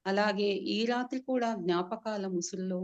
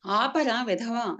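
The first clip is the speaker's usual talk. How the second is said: louder, slow angry yell; angry shout; 3 semitones higher, 5 dB louder, slower than usual. Acted. Dead man yells at crying servant, angry shout. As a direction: bark at the wailing servant. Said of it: "అని అరిచాను".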